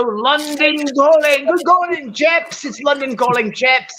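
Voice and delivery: a hint of a cheerful English presenter greeting the chaps; an American trying a British accent, not quite there; mock British accent. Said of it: "You obviously haven't perfected your accent".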